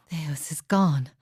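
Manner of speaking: slow, breathless whisper of grief. Acted. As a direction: whisper it as if stunned by the loss. Quiet grief at a death.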